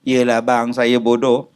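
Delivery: sulky wife. Retold or imitated. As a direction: give in with a sulk. Wife answers husband sulkily. (isteri merajuk menjawab suami)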